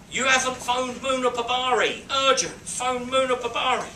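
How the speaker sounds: calling out the pager's demand, high and loud; urgent pager message. Retold or imitated. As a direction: nag like an urgent alert. Reading the second pager message.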